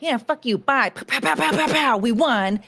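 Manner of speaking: a hint of a gloating revolutionary; mock American rebel. Streamer plays an American mocking Britain, cocky taunt. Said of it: "So we were like".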